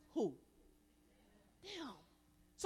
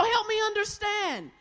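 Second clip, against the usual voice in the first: emphatic; 7 semitones higher, 5 dB louder than usual. Shown as a demonstration. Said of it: "model phrase for the crowd".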